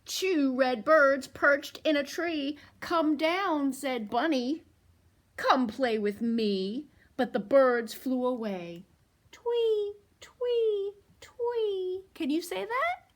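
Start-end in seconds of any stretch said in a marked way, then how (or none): 0.0-8.8 louder, slow sing-song read-aloud; picture book read to toddlers; storytime reading. A narrator's reading. 9.3-12.0 high chirpy bird call; cheerful bird tweet; bird chirp. Acted. Bird sound in story.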